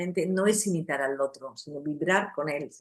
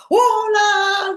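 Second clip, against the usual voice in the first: much higher, loud sung-out "Hola"; shrieked greeting; 13 dB louder than usual. Retold or imitated. Grandmother opening the door to the grandchildren, shrieking hello. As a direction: burst out with a huge shrill hello. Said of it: "se ponía".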